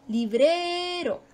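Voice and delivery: librero slow, with BRE held high; teacher's drawn-out high BRE in librero; stress on BRE. Shown as a demonstration. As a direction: stretch BRE right out in librero. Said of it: "ahí está lo más fuerte".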